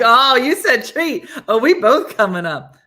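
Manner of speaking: loud laughing voice of the dog. Retold or imitated. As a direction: laugh through the dog's line. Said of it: "Lulu was like".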